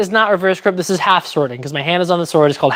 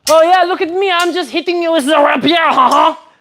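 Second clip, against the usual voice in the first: high-pitched, hammed-up French accent; mock French accent; 8 semitones higher than usual. Streamer hitting with the rapier, gloating mock French accent. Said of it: "I don't know why I'm French".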